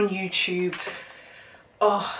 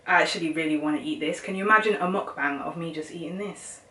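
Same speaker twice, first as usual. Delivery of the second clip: ordinary talk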